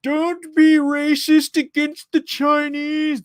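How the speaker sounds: high, slow put-on old man voice; a bad, whiny Biden impression; a hammed-up Biden pleading; terrible Joe Biden. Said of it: "This is a terrible Joe Biden".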